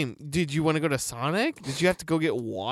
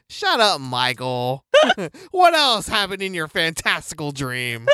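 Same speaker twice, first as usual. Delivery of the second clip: normal talking voice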